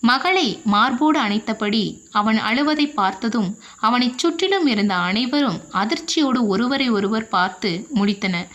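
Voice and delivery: usual speaking voice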